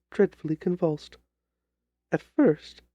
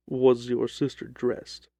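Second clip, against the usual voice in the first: lower, measured detective voice; a calm, lower Holmes cutting in; 6 semitones lower, slower than usual. Acted. Holmes questions the client.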